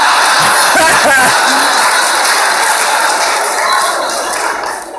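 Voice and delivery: wheezing laughter at his own story; breathy helpless laugh. Real, not acted. Comedian laughing at his own Tyson story, breathy wheeze. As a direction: laugh it out silently, shoulders shaking.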